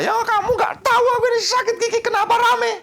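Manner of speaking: high-pitched mock complaint; put-on high voice, hammed-up grievance; irritable toothache sufferer. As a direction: play a cranky patient griping at the noise. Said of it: "Orang sakit gigi kan gitu".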